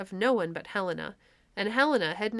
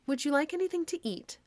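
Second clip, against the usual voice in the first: much higher, sweetly fussing queen; doting fairy queen; 8 semitones higher than usual. A narrator's reading. Titania offering the clown food, high doting voice. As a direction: fuss over him like a besotted queen.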